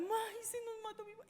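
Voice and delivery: high-pitched mock wail of panicked daughters; retold panicked cry; imitating screaming girls. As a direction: cry out high like frightened girls, without real volume. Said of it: "Porque las niñas gritaban".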